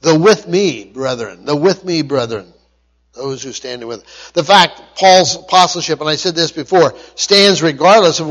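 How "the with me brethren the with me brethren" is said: "with me" raised above a low, soft "brethren"; stress on with me. Shown as a demonstration. Preacher repeating the emphasis for listeners, "with me" over a dropped "brethren". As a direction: set "with me" apart, drop "brethren" right down. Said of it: "The emphasis, again, is".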